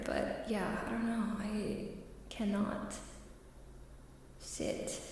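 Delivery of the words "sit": usual speaking voice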